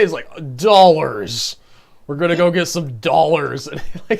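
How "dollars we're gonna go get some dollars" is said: Dollars punched hard in imitation of American speech; stress on Dollars. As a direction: lean heavily on Dollars, drawn out like an exaggerated American. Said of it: "he emphasized that so hard".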